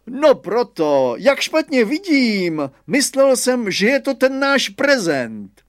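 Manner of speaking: put-on high, loud, slow old schoolmaster; headmaster, amused. Headmaster playing along with the gardener, high loud slow voice. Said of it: "usmál se a řekl".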